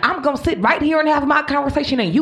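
lightly voiced bus passenger telling off the driver; sassy read. Passenger reading the rude bus driver, mock sass. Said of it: "She was reading her".

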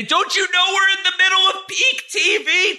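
high, laughing, exaggerated complaint; mock protest. Host protests to the creator about peak TV with a high laughing whine. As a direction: squeal it in playful disbelief.

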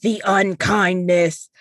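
slow, menacing declaration; ominous demon voice. Retold or imitated. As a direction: intone it like a dark chorus of ravens. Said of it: "she said".

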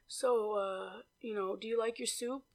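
soft, hesitant mock mumble; hushed, halting impression of a date; imitated mumbling. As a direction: imitate a guy asking under his breath. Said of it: "that's how he was talking".

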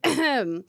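loud pointed throat clear; pointed ahem. Pretend. Doll demanding attention from her owner, loud pointed ahem. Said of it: "She's like".

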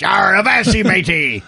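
hammed-up seafaring pirate. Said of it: "So I was thinking pirates".

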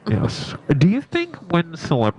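high, strange talking voice; slipping into a silly voice; odd put-on voice. Real, not acted. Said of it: "why am I talking like this?".

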